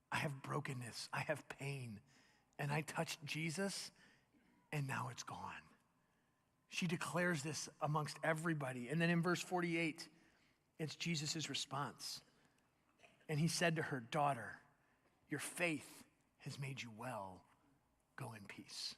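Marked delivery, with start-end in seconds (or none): 0.1-5.6 soft, low, tender; hushed testimony. Acted. The woman tells everyone her pain is gone, softly. 14.0-18.9 soft low fading to whisper; tender Jesus. Acted. Jesus gently sends the healed woman away in peace.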